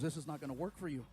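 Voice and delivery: low, quick imitation of a doctor; brisk, deep doctor voice. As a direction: deliver the verdict deep and matter-of-fact. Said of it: "He goes".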